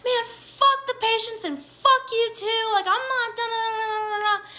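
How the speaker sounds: very high-pitched mock screaming rant; shrieky replay trailing into nonsense syllables; retold scream. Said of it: "And I scream like".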